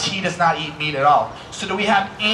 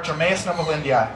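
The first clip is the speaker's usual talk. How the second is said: the magic words rattled off in one go; quick, unbroken delivery of the magic words; said a little fast; 3 semitones lower, 3 dB quieter than usual. Shown as a demonstration. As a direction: run the unfamiliar name together quickly. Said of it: "I said it a little fast".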